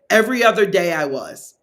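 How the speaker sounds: usual speaking voice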